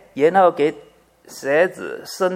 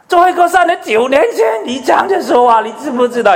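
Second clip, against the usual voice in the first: louder and higher, exasperated retelling; mock grievance rising; 9 semitones higher, 4 dB louder than usual. Preacher mimicking a person who still brings up a nine-year-old grudge, louder and higher. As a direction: hammer the old grudge again. Grudge raised yet again.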